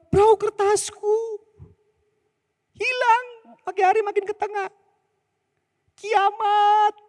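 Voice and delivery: put-on very high, whiny child near tears; a boy losing his boat, hammed-up despair; high tearful child's lament; wailing child. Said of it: "Dan dia mulai nangis".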